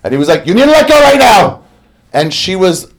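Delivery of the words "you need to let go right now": loud, high command; urgent yell. Retold or imitated. Guide yells at the model to let go of the banana, loud high shout.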